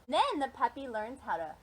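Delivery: usual speaking voice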